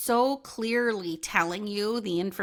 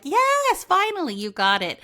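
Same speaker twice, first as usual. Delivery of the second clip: much higher, louder burst of relief; relieved yes; 9 semitones higher, 5 dB louder than usual. Pretend. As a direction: spirit cheering that you finally got it. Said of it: "like a yes nodding head".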